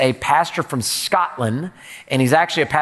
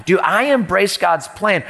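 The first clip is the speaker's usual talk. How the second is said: ordinary talk, nothing marked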